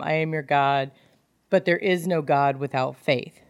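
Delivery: usual speaking voice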